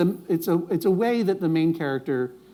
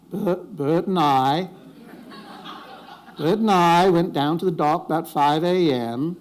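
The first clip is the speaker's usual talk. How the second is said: Maine accent. Shown as a demonstration. Host warms up with a little Bert and I, Maine accent. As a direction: do a hammed-up Down East Maine storyteller accent. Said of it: "to get into my main accent, I have to do a little Bert".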